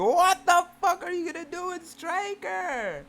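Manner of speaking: high, loud outraged shriek; shrieking in horror; horrified yell. Real, not acted. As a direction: shriek it in disbelief. Horrified reaction to story.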